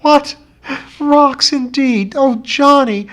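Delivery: high, slow, half-laughing disbelief; mock him with a wild, high laugh. A narrator's reading. Betty scoffing at John Thomas's rocks, high wild laughter. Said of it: "then laughed wildly".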